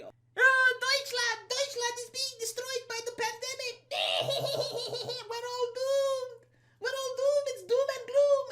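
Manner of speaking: high, wailing mock sobs with a laugh; mock crying Merkel. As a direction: ham up a tearful leader in a high whine. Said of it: "she would start crying and saying".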